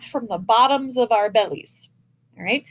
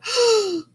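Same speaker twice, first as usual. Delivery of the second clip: sharp, tense gasping breath caught in the throat; noisy gasp; 11 semitones higher than usual. Shown as a demonstration. Band teacher demonstrates the bad throat-tight breath, a noisy gasp. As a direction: show the wrong, strained gasp of an intake. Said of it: "I shouldn't hear".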